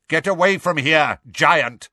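stern centaur calling up high; called out. A narrator's reading. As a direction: call out a command to a giant. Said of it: "called Megorian".

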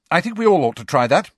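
quick, eager suggestion; keen captain pitching in. A narrator's reading. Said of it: "said Angelina".

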